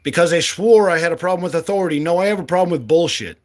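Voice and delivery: normal talking voice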